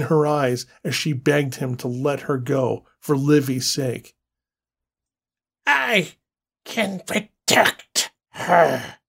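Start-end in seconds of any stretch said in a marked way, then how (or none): 5.7-9.0 slow, eerie inhuman voice. Acted. An inhuman creature speaks into his mind, slow and eerie. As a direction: speak like something not human. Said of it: "waterfall of glass".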